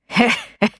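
a quick dismissive chuckle; short scoffing laugh. Acted. Bill scoffing at the helicopter tale, short laugh. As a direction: laugh it off in one short burst. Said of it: "Bill laughed".

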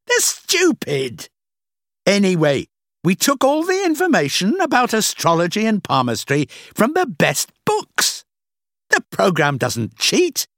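scornful rant. A narrator's reading. Boss boasting to Rachel that people are fools, scornful. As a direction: stretch the key words with loud contempt. Boss rants about foolish customers.

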